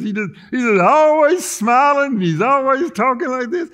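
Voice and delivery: high-pitched smiling preacher impression; hammed-up grinning voice, pitched high; Gomer Pyle. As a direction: talk through a fixed, exaggerated smile. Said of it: "He talks like this".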